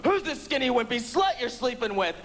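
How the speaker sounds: high-pitched imitated angry demand; mock jealous outrage. Boyfriend breaks down bedroom door, high mock outrage. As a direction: burst in demanding, hammed-up and high. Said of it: "he breaks down the bedroom door and goes".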